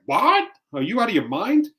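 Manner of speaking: high, loud outraged parent gasp; shocked disbelief. Retold or imitated. Other parents scolding him, loud high mock disbelief. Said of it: "there's gonna be parents like".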